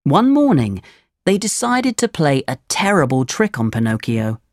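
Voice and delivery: ordinary delivery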